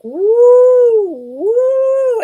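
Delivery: loud, high swooping woo; two big drawn-out high whoops; imitated shaking house. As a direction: wail like a lurching house. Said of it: "the house was like".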